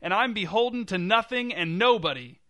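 high, loud defiant declaration; shouted. A narrator's reading. Jerry defying mom and audience, shouted. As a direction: shout it out in open rebellion. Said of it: "shouted Jerry".

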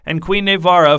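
plain reading voice

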